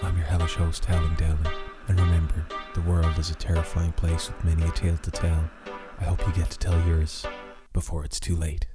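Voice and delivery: ordinary talk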